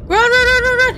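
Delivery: high-pitched rapid panic cries; panicked urging. Real, not acted. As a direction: urge them on in a fright. Urging character to flee in game.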